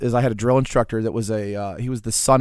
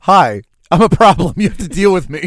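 higher, louder cartoon menace; comic gator throwing its weight around; talking gator; 6 semitones higher, 7 dB louder than usual. Gators greet a recruit in their pit, mock-threatening voice.

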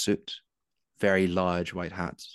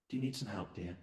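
soft, pleasant voice; 2 semitones higher, 12 dB quieter, faster than usual. Acted. Mysterious voice offering help in Evangeline's head, very soft. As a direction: speak gently, very quietly, as if inside their head. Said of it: "It's a soft, pleasant voice".

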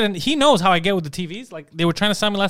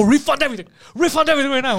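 mock angry demand; 6 semitones higher, faster than usual. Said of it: "I went crazy".

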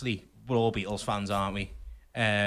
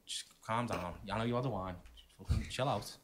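calming down; 4 semitones lower, 10 dB quieter than usual. Retold or imitated. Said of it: "she went, I thought".

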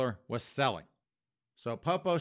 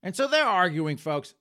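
ordinary delivery

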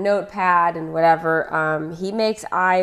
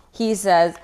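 usual speaking voice

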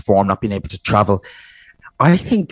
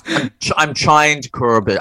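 laughing; 2 semitones higher, slower than usual. Real, not acted. Laughing at being teased.

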